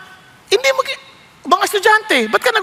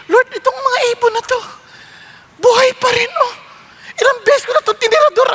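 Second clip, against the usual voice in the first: higher, mock-amazed cry; amused exclamation; 6 semitones higher than usual. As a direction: marvel half-laughing to the Lord. Man marvels at birds surviving. (pabirong gulat na sigaw)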